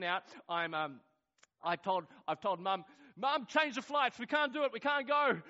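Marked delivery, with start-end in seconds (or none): 3.2-5.4 high, loud re-enacted panic; panicked plea. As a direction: beg urgently as if all is lost. Panicked plea to Mum in retelling.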